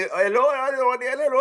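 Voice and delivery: put-on high, loose gibberish in an Indian accent; mock Indian accent. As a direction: caricature an Indian agent jabbering. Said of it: "Indian people, they don't give a fuck. They'll be rude".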